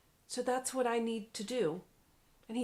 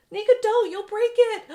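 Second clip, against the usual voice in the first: loud, high alarmed yell; panicked shout; 12 semitones higher, 15 dB louder than usual. Acted. Jabari yelling at Nika to leave his machine, loud and alarmed. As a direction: shout to stop her before it breaks.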